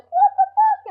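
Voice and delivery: high loud mock outrage; imagined viewer shouting in shock; imitated viewer. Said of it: "they'd probably be like".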